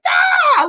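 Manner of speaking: shrill, very high loud yelp; cartoon dog scream. Imitating Courage screaming in terror, very high and loud. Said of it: "He was like".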